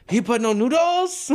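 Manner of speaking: high, slow mock Japanese accent. Sushi worker on the phone, mock Japanese accent. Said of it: "I'll do the accent".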